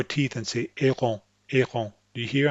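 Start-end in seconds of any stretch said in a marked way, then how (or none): 0.8-1.9 French-sounding AARON, no English R. Shown as a demonstration. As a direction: say AARON the French way, tongue pushed forward. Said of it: "keep the tip of the tongue touching".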